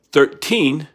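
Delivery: put-on THIRTEEN split into two beats, thir-teen; two syllables. Shown as a demonstration. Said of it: "you get two syllables".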